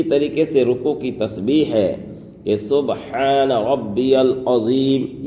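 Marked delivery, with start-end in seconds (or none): 2.6-5.3 slow سبحان ربی العظیم with every letter clear; سبحان ربی العظیم with the ha in سبحان sounded; correct recitation. Shown as a demonstration. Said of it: "یہ صحیح تلفظ ہے".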